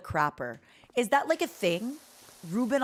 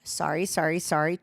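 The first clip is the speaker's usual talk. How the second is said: ordinary talk, nothing marked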